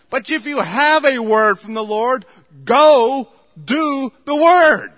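loud, high, driving pulpit preaching; preaching at full force. Acted. Pastor exhorting his church, preaching at full force. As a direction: hammer the point home to the congregation. Fiery sermon on waiting.

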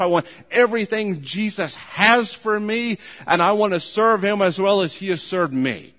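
loud, driving sermon delivery, building on each "I want"; preaching at full force. Acted. Preacher urging the congregation, preaching at full force. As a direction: pound every line home to the pews. Preaching his life's goal.

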